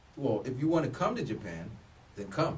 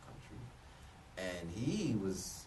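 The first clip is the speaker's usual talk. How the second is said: ordinary talk, nothing marked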